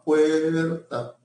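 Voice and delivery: "puerta" with the UE drawn out; stressed diphthong. Shown as a demonstration. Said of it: "sílaba tónica pasó a".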